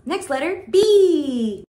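excited. Real, not acted. Announcing next letter excitedly.

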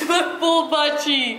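slow words through helpless laughter; laughing hard. Real, not acted. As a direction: laugh so hard it nearly cries.

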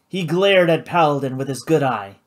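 ordinary delivery

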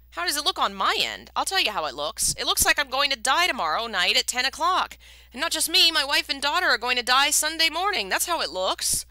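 rising to a loud desperate cry; bitter outburst. A narrator's reading. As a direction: throw the question back, then let it break loud. Condemned man answers bitterly.

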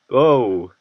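loud startled exclamation; surprised shout. Real, not acted. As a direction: yelp in sudden surprise. Sudden outcry at result.

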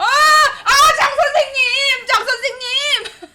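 high slow laughing cry; howling in laughing despair; laughing wail. Real, not acted. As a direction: wail the name through laughter. Streamer wailing, laughing.

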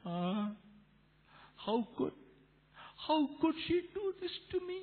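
high, soft self-pitying lament; acted wounded complaint; hurt and depressed. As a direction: wallow in the hurt in a small high voice. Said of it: "plum means depressed. We become sad".

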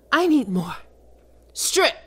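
loud, high barked order; sharp command. Acted. Succubus ordering her pet to strip, loud command.

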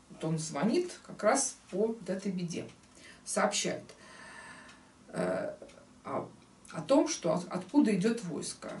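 usual speaking voice

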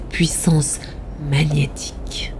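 slow, fading near-whisper; mysterious hush, low and drawn out. A narrator's reading. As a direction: let it sink slowly into a low whisper. Narrator evokes hypnotic power.

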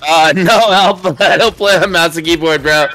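loud, high laughing protest; laughing outburst. Real, not acted. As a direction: blurt it out through laughter. Laughing outburst after loss.

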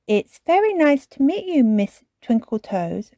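politely, starting high and dropping to normal; lightly voiced, well-mannered child character; polite greeting. A narrator's reading. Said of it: "said Susie politely".

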